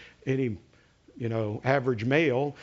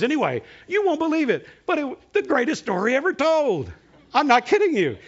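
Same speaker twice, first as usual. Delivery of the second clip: amazed; 10 semitones higher, 5 dB louder than usual. Real, not acted. Amazed the movie was on TV.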